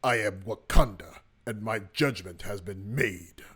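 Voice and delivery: low, slow regal proclamation, stressing "Wakanda" and "made". Acted. Teacher voicing a comic book hero, low solemn declaration. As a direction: pronounce it low and weighty, drawing out "made".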